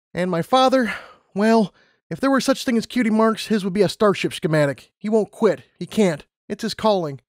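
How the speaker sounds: high-pitched pleading applicant; Lumina, protesting. A narrator's reading. Lumina challenging the interviewer's rejection, high protesting voice. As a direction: argue back, pitch held high throughout. Lumina argues about her father.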